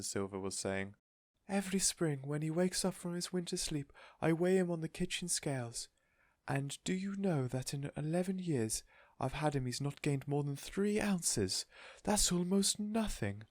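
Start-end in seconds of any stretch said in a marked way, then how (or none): 1.5-13.4 doting lady. A narrator's reading. Missus Silver complaining to Mister Hoppy, fretful. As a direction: fret over the pet.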